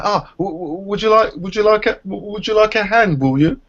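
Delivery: high, stammering mock gentleman offering help; lightly hammed-up polite old man, stumbling over the offer; imitating older gentleman. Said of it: "this older gentleman said".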